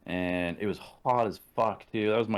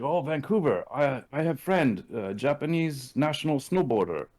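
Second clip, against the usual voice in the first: put-on a lightly done Japanese accent in English, pitched higher; mock Japanese accent, higher and eager; imitating Nobu's Japanese accent; 6 semitones higher than usual.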